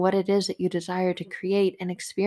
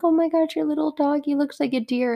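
put-on delighted older lady; 7 semitones higher, 3 dB louder than usual. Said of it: "older lady ... is like".

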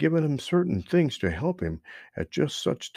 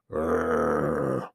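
low rumbling growl; deep animal growl; bear growl; 8 semitones lower than usual. Acted. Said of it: "growled Buster Bear".

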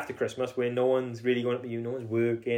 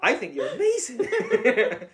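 mocking quote; 10 semitones higher, much slower than usual. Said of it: "It's like".

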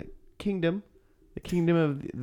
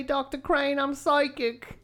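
put-on much higher, slow British accent; high-pitched Mancunian housekeeper voice; Daphne; 11 semitones higher than usual. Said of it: "Just imitate Daphne".

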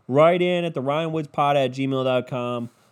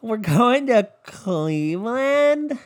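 higher, slow, drawn-out outraged whine; shocked girls; 6 semitones higher, much slower than usual. Retold or imitated. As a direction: stretch it out like a horrified reality-show girl. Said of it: "And they all go".

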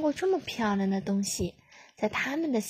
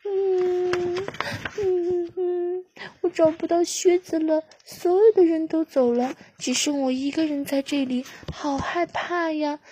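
high, whimpering chick voice, slowing at the end; a lost chick whimpering for help; whimpering high little chick, scared and alone; crying little chick; 7 semitones higher, 4 dB louder than usual. Acted. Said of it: "立刻停止了哭泣".

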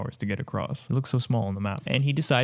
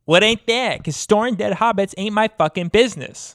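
mock gangster shout; 8 semitones higher, 7 dB louder than usual. Pulp Fiction parody line.

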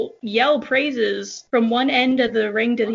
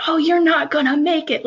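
mild mock teasing, a bit higher and louder; teasing trainer; 6 semitones higher, 4 dB louder than usual. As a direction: imitate the coach playfully needling the rider. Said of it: "kinda laughing at me, like, teasing me".

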